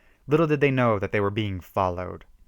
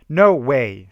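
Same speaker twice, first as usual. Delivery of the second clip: rude; 3 semitones higher, 9 dB louder than usual. A narrator's reading. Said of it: "Stan said rudely".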